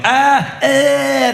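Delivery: high-pitched cry of disgust; hammed-up wail of embarrassment; pained groan. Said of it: "I'm like".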